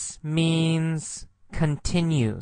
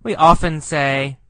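ordinary talk, nothing marked

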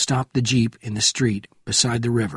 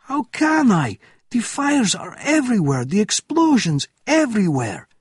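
very high, loud, frantic officer; panicked protest; 11 semitones higher, 4 dB louder than usual. A narrator's reading. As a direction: protest helplessly at a raised pitch. Panicking officer protests Bourne's order.

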